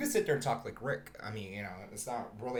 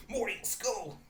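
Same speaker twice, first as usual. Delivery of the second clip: higher, burpy cartoon summons; Rick; 8 semitones higher than usual. Scientist leaving for another dimension, mock-huffy Rick voice. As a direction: order the sidekick off in a drunken voice. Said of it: "He would have said".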